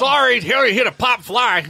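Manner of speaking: high, loud old announcer impression; laughing, hammed-up play-by-play; Harry Caray. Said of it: "when you do that".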